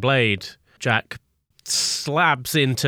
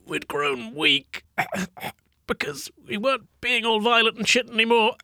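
high, weak dying gasp; dying villain; 9 semitones higher than usual. Acted. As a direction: gasp out last words between coughs. Dying villain's last words.

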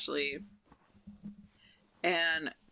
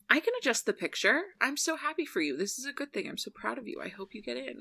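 sweet supportive Amy; 7 semitones higher, faster than usual. Retold or imitated. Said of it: "And she goes".

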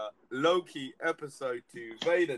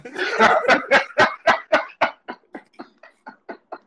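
long high laugh fit; loud wheezing laugh; 11 semitones higher, 14 dB louder than usual. Real, not acted. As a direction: break up laughing. Laughing hard at story.

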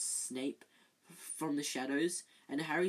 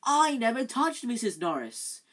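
louder, higher protest; said loudly; 8 semitones higher, 9 dB louder than usual. Acted. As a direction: insist loudly before everyone. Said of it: "Harry said loudly".

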